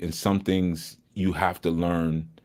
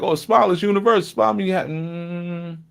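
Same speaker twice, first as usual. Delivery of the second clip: much higher, fast mock naive voice; naive know-it-all; 9 semitones higher than usual. Mocking a naive listener, high and quick. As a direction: play the gullible one who thinks a smile is universal. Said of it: "one might think like".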